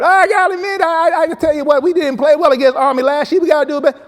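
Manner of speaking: high, loud, fast-talking coach voice. Retold or imitated. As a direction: blast it out like an excited Southern coach. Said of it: "he would just go on and on".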